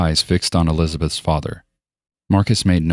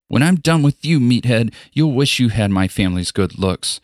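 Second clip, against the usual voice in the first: enraged threat; 6 semitones higher than usual. Acted. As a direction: threaten him, furious at the start and cooling off. Vain girl flies into rage.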